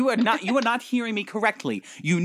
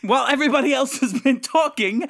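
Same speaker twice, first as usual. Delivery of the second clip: higher-pitched, defensive protest; indignant professor; 6 semitones higher, 3 dB louder than usual. Acted. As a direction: justify yourself in a huffy accented professor voice. Professor indignantly defends his poor reading.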